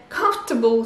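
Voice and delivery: comfortable with the or swallowed, two syllables; English comfortable. Shown as a demonstration. Teacher repeating comfortable for students. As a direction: say comfortable as kumf-tuh-bul. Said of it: "Et le fort disparaît".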